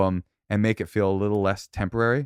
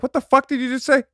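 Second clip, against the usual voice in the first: much higher, louder outraged squawk; mock outrage; 5 dB louder than usual. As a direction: fake fury at the insult. Acting out an outraged reply.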